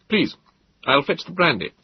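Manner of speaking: high, hurried polite host; flustered host. Acted. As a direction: remember your manners, a bit flustered. Host offers brandy.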